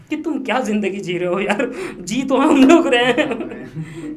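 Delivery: laughing through the words, slow and loud. Real, not acted. Speaker to host, laughing at rich people's lives, laughing proudly. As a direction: chuckle it out, proud and amused.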